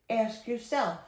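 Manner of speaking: plain reading voice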